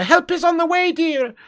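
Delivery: high-pitched, fluting film-nanny voice; hammed-up high falsetto impression; Mrs Doubtfire. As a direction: sing it out high like a dotty housekeeper. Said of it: "Missus Doubtfire".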